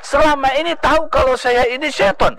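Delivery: high-pitched exclamation, no added volume; devil's acted high-pitched outcry; imitated cry. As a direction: cry out in startled realisation. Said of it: "ya, teriak".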